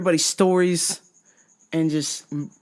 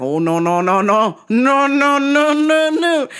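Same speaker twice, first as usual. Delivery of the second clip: no's climbing higher and louder; rising panic; 9 semitones higher, 5 dB louder, slower than usual. Retold or imitated. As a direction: panic building with each no. Building panic protest.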